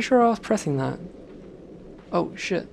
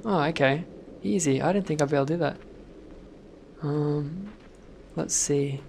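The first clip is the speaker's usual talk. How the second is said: normal talking voice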